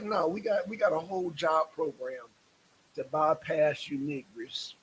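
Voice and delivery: ordinary talk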